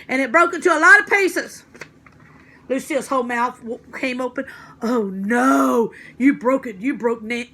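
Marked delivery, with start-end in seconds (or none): 0.1-1.6 louder and higher on the big moment; dramatic loud finish. Acted. Junie reaches the dramatic climax. 4.8-7.5 loud, upset cry of dismay; dismayed wail. Acted. Lucille dismayed at broken glass.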